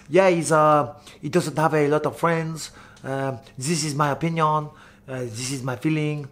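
imitating a French fighter's English; French accent. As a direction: put on a quiet French accent. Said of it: "not gonna do a French accent".

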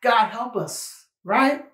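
usual speaking voice

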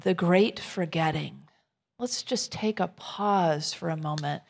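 ordinary delivery

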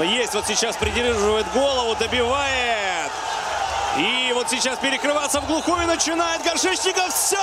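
rising excited play-by-play call; excited commentary. Real, not acted. Finishing blow in live fight.

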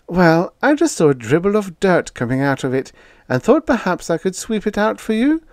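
high, chirpy helpful landlady; innocently helpful; landlady explaining. A narrator's reading. Landlady offers to sweep out her tenant's room, high and chirpy.

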